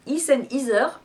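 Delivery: "Heath and Heather" said the French way, h dropped, "Heather" as "izœʀ"; French-accented brand name; French accent on English. Shown as a demonstration. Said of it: "avec l'accent français".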